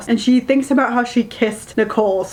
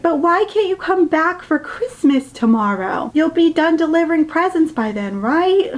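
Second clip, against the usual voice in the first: lightly voiced child, higher and rising; a hint of a little girl begging; pleading little girl; 7 semitones higher than usual. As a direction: niece asking her aunt to come back, lightly childlike. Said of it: "It's like".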